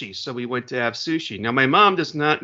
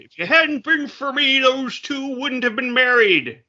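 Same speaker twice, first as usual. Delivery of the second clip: mock boast; 11 semitones higher, 3 dB louder than usual. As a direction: play it like a proud matchmaker. Joking boast about matchmaking.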